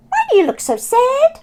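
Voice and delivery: high, loud squeaky rat greeting. Acted. Robert the rat meeting Oscar, high squeaky hello. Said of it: "Robert asked".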